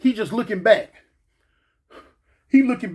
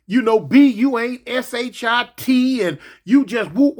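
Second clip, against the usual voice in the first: ordinary delivery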